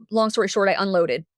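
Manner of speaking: ordinary talk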